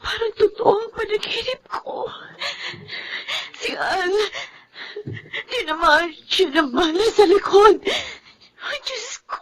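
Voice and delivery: high, broken sobbing, falling soft; weeping. Acted. Wife tells her sister about the husband shot in her dream, weeping. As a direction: cry through every word.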